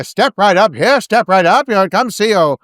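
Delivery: high, loud carnival barker call; ringmaster. Acted. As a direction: sell it like a snake oil seller. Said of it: "he's the ringmaster".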